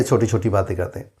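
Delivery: usual speaking voice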